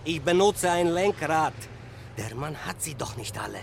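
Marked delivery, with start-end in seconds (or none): 2.2-3.6 low, soft grumble to himself; muttered aside. Acted. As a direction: grumble it under your breath.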